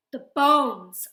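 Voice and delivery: sudden loud exclamation; abrupt, very loud outcry; blurted out. Acted. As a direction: burst out with it, stretching the last word. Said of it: "I blurt out".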